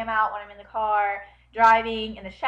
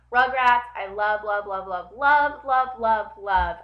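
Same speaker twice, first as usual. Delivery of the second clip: loud, repeated punches on "love"; gushing emphasis; 7 dB louder, slower than usual. Real, not acted. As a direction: hammer every "love" with loud devotion. Gushing over favorite childhood show.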